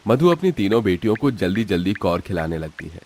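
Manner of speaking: plain reading voice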